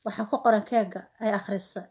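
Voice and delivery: ordinary talk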